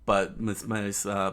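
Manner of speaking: plain reading voice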